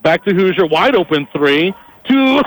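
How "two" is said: excited call. Real, not acted. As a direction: shout the score call out. Shot goes in.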